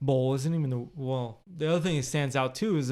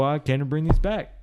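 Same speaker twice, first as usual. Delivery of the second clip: firm loud; 7 dB louder than usual. Retold or imitated. Confronting his father.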